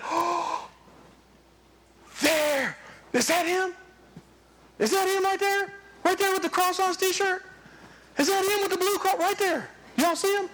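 straining to see if it's really him; eager father spotting his son. Acted.

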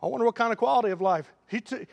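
ordinary talk, nothing marked

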